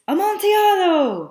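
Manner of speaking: high, loud excited cry of the word; mock-excited wine lover; acted Amontillado. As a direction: call out Amontillado like the eager Fortunato. Teacher voicing Fortunato's excited repeat.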